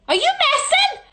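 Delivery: very high, loud outburst; loud squeal of disbelief; incredulous shriek. Real, not acted. Outraged at the answer.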